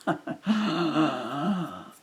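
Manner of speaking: high, loud burst of laughter; laughing. Real, not acted. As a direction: laugh out loud at your own joke. Laughs at own asylum remark.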